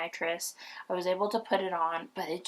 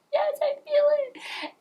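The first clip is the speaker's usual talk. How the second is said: very high, slow, elated; ecstatic relief; 6 dB louder, slower than usual. Retold or imitated. Mother answers the nurses, very high and elated. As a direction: let the relief pour out. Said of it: "I'm like".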